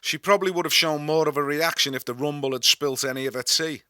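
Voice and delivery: plain reading voice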